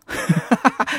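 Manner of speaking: a short, high, teasing laugh; mocking laugh. Real, not acted. Host laughing at listeners who missed the joke, teasing. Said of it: "让我嘲笑一下".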